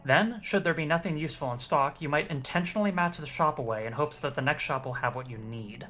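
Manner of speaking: ordinary talk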